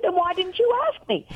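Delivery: high-pitched retold complaint; playfully exasperated wail of a question; mock exasperation. Wife retelling complaint to husband, mock exasperation.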